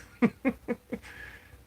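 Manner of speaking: high, breathy laugh; real burst of giggling. Real, not acted. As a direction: laugh at your own crazy story. Laughs at own story.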